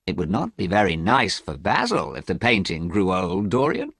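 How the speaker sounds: plain reading voice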